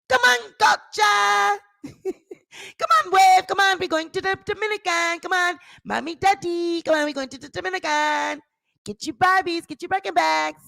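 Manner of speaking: high excited calling out; gleeful rallying call. Pretend. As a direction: call everyone to the trip. Acting [name] calling to vacation.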